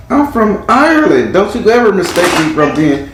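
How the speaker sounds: high-pitched, indignant mock scolding; offended retort. Retelling offended person's rebuke.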